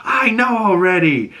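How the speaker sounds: mother finally admits what she knows; weary mother. Retold or imitated. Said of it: "she goes".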